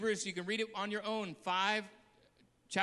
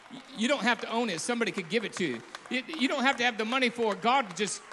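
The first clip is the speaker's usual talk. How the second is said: preaching at full force; 2 semitones higher, 7 dB louder, faster than usual. Acted. Preacher urging the church to go out, shouting. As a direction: thunder it to the congregation.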